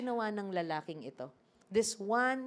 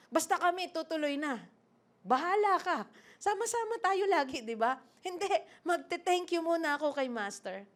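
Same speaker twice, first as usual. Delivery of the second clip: dismissive retort; 8 semitones higher than usual. Acted. Nine lepers argue in story.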